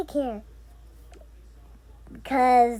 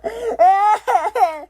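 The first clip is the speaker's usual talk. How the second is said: laugh; 7 semitones higher, 6 dB louder than usual. Real, not acted. Child laughing in pretend play.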